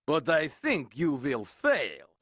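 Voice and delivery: low, smug eagle voice; smug parting jab; sneering eagle. Acted. Eagle mocking Horton, smug. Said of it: "sneered the bird".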